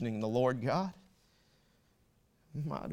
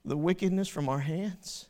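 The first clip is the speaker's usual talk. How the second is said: loud, rising sermon voice; preaching at full force; 7 semitones higher, 12 dB louder than usual. Acted. Preacher to congregation, loud full-force preaching. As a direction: drive it home to the congregation.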